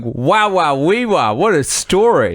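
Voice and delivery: high, drawn-out mock amazement; impressed listeners imitation. Cohost imagines two hosts hearing the story, high mock awe. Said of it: "Guy and Tim are going".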